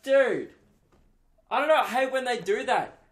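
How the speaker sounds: exasperated outburst. Retold or imitated. Patient venting about doctors slipping in risks, exasperated high outburst. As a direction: vent it up high and loud.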